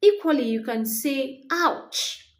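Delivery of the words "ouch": high, sudden yelp of pain; a sharp high ouch. Shown as a demonstration. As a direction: cry out as if hurt. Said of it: "When you make this, this sound".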